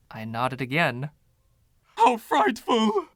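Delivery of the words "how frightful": very high, slow, loud theatrical horror; horrified. Acted. Ghost horrified his home was divided, theatrical. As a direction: gasp it out like a scandalized dandy.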